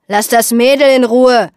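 loud protective shout; called out. Acted. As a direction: bark the order at full voice. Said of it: "rief Jensen".

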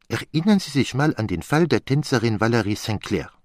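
put-on exasperated French-accented detective; Poirot. Chiding his friend for seeing only the inessential, as an exasperated Poirot. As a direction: scold lightly with animated French lilt.

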